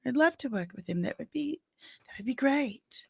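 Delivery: imitated interviewee. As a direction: quote her softly, fading to a high whisper. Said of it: "where she was like".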